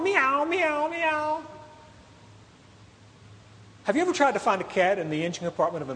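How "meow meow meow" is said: high, mewing cat imitation; cat meowing. Cat stuck in the minivan engine, high mewing. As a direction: mimic a cat crying out from inside the engine. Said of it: "in the engine compartment going".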